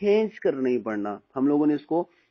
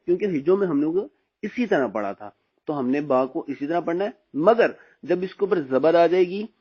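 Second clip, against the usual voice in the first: normal talking voice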